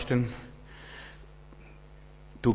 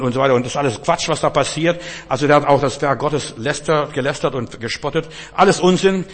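louder, higher, heated retelling; forceful preaching; 4 semitones higher, 5 dB louder than usual. Acted. Preacher telling the congregation about the defiant man, louder and higher. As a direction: drive the story home with rising heat.

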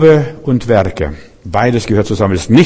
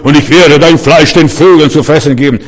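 boastful threat, louder, swaggering; großspurige Drohung; 3 semitones higher, 5 dB louder than usual. Retold or imitated. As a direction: bluster it like a scared braggart. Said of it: "groß zu reden". (großspurig drohend, laut)

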